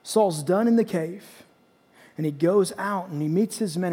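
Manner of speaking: ordinary delivery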